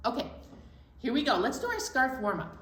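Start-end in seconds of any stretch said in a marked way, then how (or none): none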